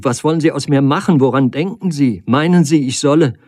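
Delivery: crying out in despair; cries out. A narrator's reading. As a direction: plead in alarm, pushing him off. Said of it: "rief sie".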